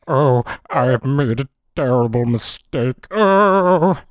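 mock-tragic father groaning over his error; father's voice. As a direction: wail the mistake like a choked ham actor. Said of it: "do the father's voice".

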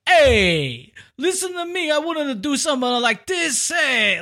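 put-on high-pitched, thick-accented gangster outburst; indignant crime boss with a hammed-up foreign accent; upset crime lord. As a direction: play an offended mob boss, voice shooting up. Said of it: "he's all upset".

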